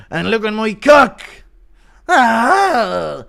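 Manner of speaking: high, loud villainous Irish put-on; hammed-up comic-book villain, stretched out; Scarecrow Conor. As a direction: wail it high like a mock Irish supervillain. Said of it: "Impression of Scarecrow Connor using his poison gas".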